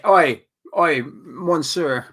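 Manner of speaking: loud, hammed-up Scottish-tinged French with a shouted Oi; a Scottish manager mangling French, played up loud; mock David Moyes. A host mocks David Moyes greeting the Lyon manager in loud Scottish-French. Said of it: "Or Scottish".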